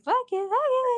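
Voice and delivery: very high chanted ad-lib; ad-libbing. Retold or imitated. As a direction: toss it off high like a silly studio riff. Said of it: "I was just ad-libbing".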